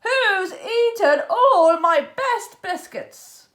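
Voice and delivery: loud, slow indignant demand; angry demand. A narrator's reading. Captain discovering eaten biscuits, loud slow anger. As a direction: demand it in outrage.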